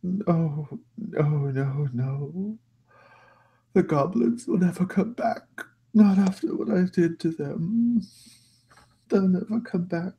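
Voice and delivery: put-on high-pitched, mournful old master; guilt-ridden master, despairing. Master grieving what he did to the goblins, despairing. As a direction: lament it high, sinking into despair.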